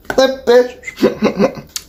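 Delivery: high vocal tic into a run of hiccup noises; sudden high yelp, then stuttered hiccups; involuntary hiccup tic noises. Real, not acted. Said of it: "you know the hiccup noise I make".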